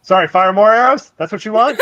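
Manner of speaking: loud, high indignant yell back; distant shouting. Acted. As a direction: holler it from across the battlefield, near laughter. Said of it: "You can just hear off in the distance".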